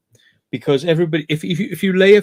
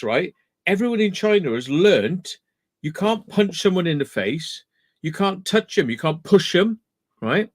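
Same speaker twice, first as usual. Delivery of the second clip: ordinary talk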